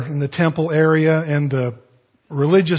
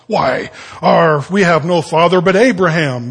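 indignant protest; 8 dB louder than usual. Retold or imitated. Religious leaders protest indignantly.

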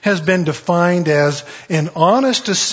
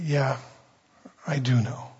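humble Lord's reply; 3 semitones lower, 8 dB quieter than usual. Retold or imitated. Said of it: "not in a prideful, arrogant way".

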